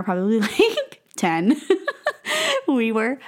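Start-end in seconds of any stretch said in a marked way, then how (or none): 1.6-2.6 high laugh. Real, not acted. Laughing at childhood fear.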